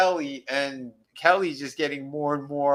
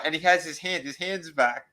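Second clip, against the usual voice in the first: ordinary talk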